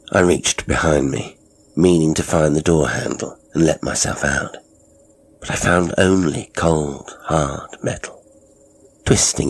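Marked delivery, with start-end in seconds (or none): none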